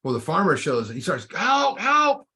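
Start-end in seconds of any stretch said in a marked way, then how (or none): 1.3-2.3 high, loud cries of "Help, help"; mock cry for help. Man stuck in a hole calling for help, high and loud. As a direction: call up out of the hole, much higher and louder.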